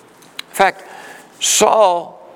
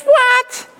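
a sudden "What?" leaping far higher; shocked disbelief; 4 dB louder than usual. Acted. Jonathan hearing his father wants David dead, shocked. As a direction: react like you can't believe your ears.

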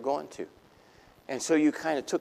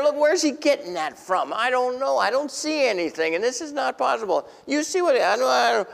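stunned onlooker; astonished crowd; 10 semitones higher than usual. Retold or imitated. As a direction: sputter in disbelief. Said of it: "And they're".

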